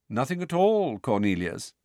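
plain reading voice

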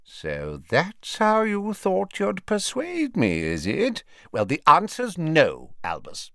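high, slow character voice, dropping at the end; wary wizard seeing through a scheme; shrewd old wizard. A narrator's reading. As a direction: refuse him knowingly, stretching the words. Voices Slughorn refusing Dumbledore.

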